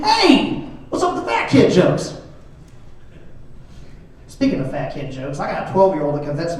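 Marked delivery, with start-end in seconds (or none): 0.0-2.1 much higher, indignant complaint; mock protest. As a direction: whine about the fat jokes in mock outrage.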